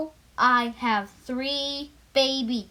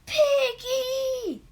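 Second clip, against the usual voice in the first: alarmed cry; 10 semitones higher than usual. Acted. Elephant cries out in alarm.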